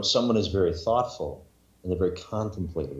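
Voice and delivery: usual speaking voice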